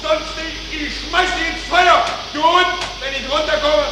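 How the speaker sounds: high, strained angry yelling; furious shouting. Acted. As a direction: rage at the rotten pipes. Furious quarrel in engine room.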